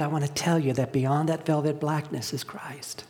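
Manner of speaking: breathy hushed confidence, leaning in; hushed whisper. Real, not acted. Preacher tells the congregation of hope, whispered.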